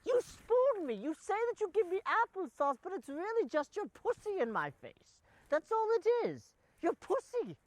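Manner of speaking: high-pitched British outrage; hammed-up indignant protest; indignant accusation. Reopens the quarrel over pudding.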